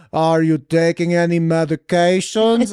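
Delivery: loud imitation of a Russian doctor; thick Russian accent. Funny doctor questioning his patient, thick Russian accent. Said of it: "this thick Russian accent".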